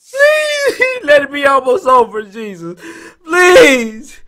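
high drawn-out "please", tearful; whimpering plea. Real, not acted. Streamer prays the game is almost over, whimpering plea. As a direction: beg for it to end, near tears.

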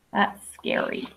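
normal talking voice

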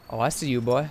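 normal talking voice

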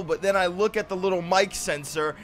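ordinary talk, nothing marked

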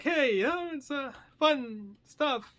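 high, slow, hesitant playfulness; casual, unserious. Retold or imitated. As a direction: sell it as harmless fun in a high voice. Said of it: "my voice is like".

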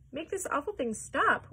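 loud, high-pitched demand; urgent order. A narrator's reading. Mister T V demanding the elevator stop, loud high order. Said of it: "ordered Mister T V".

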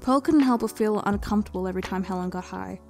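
ordinary delivery

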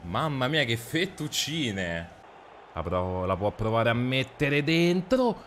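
ordinary talk, nothing marked

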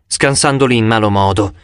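ordinary delivery, nothing marked

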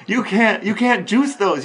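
ordinary talk, nothing marked

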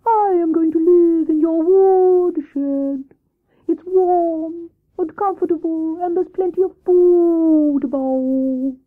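high, slow hooting owl; Wise Owl voice. Acted. Owl questions Hare about the rabbit, slow hooting voice. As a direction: draw the vowels out like hoots.

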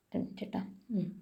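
a quick whispered "one minute"; hushed off-mic aside. Real, not acted. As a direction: step away and whisper. Aside off-mic during lesson.

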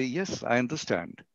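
quiet, calm "Yes, I understand"; calm the shouter gently; reassuring soft reply; soft answer. Shown as a demonstration. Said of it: "a soft answer".

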